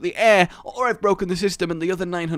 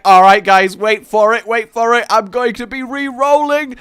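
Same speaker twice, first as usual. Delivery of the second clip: a much louder, higher mock announcer; hammed-up hype voice; 8 semitones higher, 12 dB louder than usual. Imagined rerolled self hyping up the crowd, mock-loud. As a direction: ham up the big reveal. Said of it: "flashing a load of accents".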